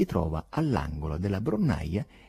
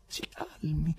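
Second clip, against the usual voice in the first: whisper; 9 semitones higher, 5 dB quieter, slower than usual. Acted. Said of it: "borbottava Berlioz".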